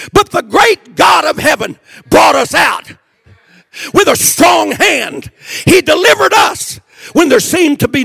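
high, shouted sermon cadence; ringing revival shout; full-force preaching. Real, not acted. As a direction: proclaim it loudly, line after line. Preaches God's deliverance at full force.

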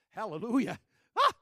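loud, high joyful cry; excited hallelujah. Real, not acted. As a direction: burst out with joy. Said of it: "I'm getting excited".

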